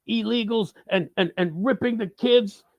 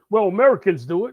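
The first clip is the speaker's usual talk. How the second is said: mock opponent; 7 dB louder than usual. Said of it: "please don't tell me".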